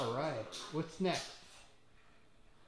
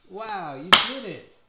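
excited praise; 15 dB louder, slower than usual. Real, not acted. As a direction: cheer the little one on. Praising toddler's puzzle success.

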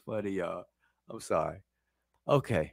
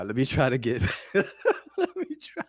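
laughing, can't get serious. Real, not acted. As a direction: try to steady yourself but keep cracking up.

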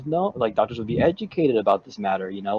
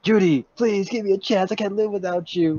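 higher-pitched melodramatic begging; acted pleading; 9 semitones higher than usual. Nick begs Judy for another chance, acted pleading. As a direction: beg like a desperate lover in a soap opera. Acted lover's plea in comic.

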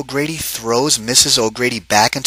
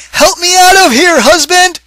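high, loud cry for help; 7 dB louder than usual. Acted. Wife stuck in the pot cries to her husband, high and loud. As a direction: yell up from inside the pot. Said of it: "she cried".

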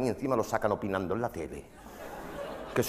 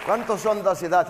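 mock interviewer; 7 semitones higher, 5 dB louder than usual. Interviewer question repeated.

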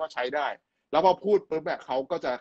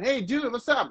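put-on casual teen greeting; 10 semitones higher, much slower than usual. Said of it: "ไปเจอเพื่อน".

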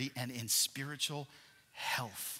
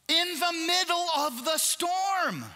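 slow, high, shouted; climactic proclamation; 13 semitones higher, 7 dB louder, slower than usual. Real, not acted. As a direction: drag it out and cry it over the crowd. Preacher's climactic line.

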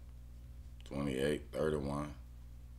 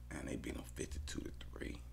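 quick, much softer whisper; fast whisper; 3 semitones lower, 12 dB quieter, much faster than usual. Real, not acted. Bettor murmuring a blowout score, fast whisper. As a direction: hush it like a secret.

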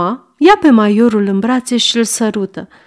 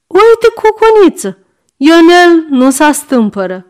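put-on high, loud old woman calling from next room; old servant woman; 7 semitones higher, 4 dB louder than usual. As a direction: cry out like a fussing old maid. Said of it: "o voce răgușită de femeie bătrână".

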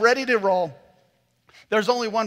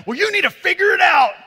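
higher, loud shouted scolding; angry neighbor; 11 semitones higher, 10 dB louder than usual. Retold or imitated. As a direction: yell at the clueless dad. Angry neighbor yelling at him.

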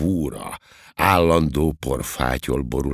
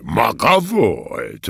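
high, drawn-out, joyful exclamation; gleeful, louder and slow; delighted; 8 semitones higher, 4 dB louder, much slower than usual. A narrator's reading. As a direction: greet the culprit with great delight. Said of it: "mondja az őrmester örvendezve".

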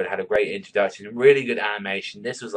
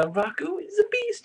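hyped. Real, not acted. Hyping the character.

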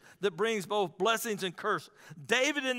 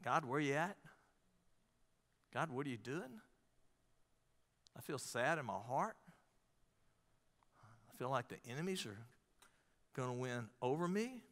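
soft, low, understated plea; pleading with God; 7 semitones lower, 10 dB quieter than usual. Acted. David crying out to God in despair, soft pleading. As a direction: quietly act out a downcast prayer, with pauses. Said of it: "He's crying, he's pleading with God".

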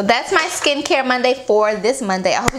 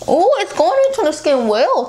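muffled through fingers; speaking while massaging face; hands over mouth; 8 semitones higher than usual. Real, not acted. Seen 2: fingers massage cheeks and partly cover lips while speaking.

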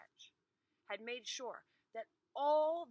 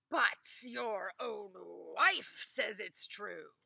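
loud, slow, pressing; stern master; 8 dB louder, slower than usual. Acted. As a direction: corner him with it.

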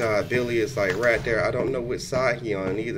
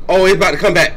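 loud panicked cry; 4 semitones higher, 9 dB louder, faster than usual. Real, not acted. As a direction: panic as it comes back. Monster about to return.

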